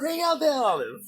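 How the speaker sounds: loud, high yell; shouting the order like a rowdy studio crowd; mock shout. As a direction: bellow the demand, hammed up. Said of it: "Maury Olive".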